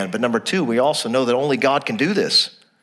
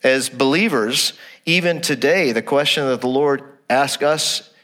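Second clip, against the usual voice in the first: ordinary talk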